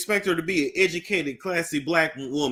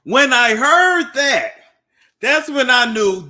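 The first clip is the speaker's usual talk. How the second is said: high, slow, loud exclamation; loud outburst; 10 semitones higher, 10 dB louder, slower than usual. Real, not acted. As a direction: stretch it out in loud disbelief. Shocked reaction to audio clip.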